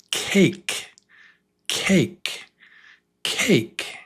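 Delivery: cake with a long stretched "ay". Shown as a demonstration. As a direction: say cake slowly, the A saying its name. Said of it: "For example".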